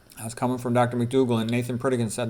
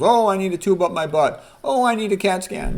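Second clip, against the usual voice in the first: mocking patient; 7 semitones higher than usual. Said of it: "They like flashy bells and whistles".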